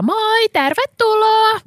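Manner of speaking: high, drawn-out sing-out greeting; called-out welcome. Retold or imitated. Host calling to a customer coming in, called-out welcome. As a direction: imitate someone calling out a welcome, stretching it high. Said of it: "Sit siellä kuitenkin joku huutaa".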